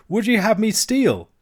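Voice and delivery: high-pitched, loud indignation; show of fury. A narrator's reading. Alan challenges the maid about getting a horse, furious. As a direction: demand it sharply, way up high. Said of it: "with the same appearance of fury".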